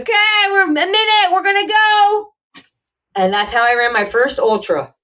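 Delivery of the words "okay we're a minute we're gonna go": high, announcing the start; race starter call. Retold or imitated. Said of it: "And they're like".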